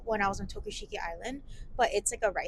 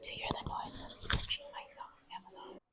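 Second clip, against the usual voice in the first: whispering; 8 semitones higher, 6 dB quieter than usual. Real, not acted. Whispering in a cave.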